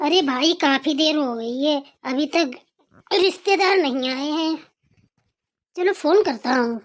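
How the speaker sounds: impatient father. Acted. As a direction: grumble that the guests are late. Father waits for guests.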